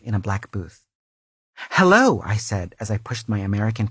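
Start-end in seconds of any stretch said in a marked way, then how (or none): 1.7-2.2 bright greeting. Acted. Greeting customs agent in story.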